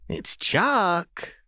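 high, sweet threat; menacing singsong insist. A narrator's reading. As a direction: press it with a smile. Jinx insists with gun.

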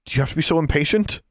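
high-pitched, fast complaint; exasperated youngster, rushed and high; irritated girl. Acted. Girl snaps at impatient sphinx.

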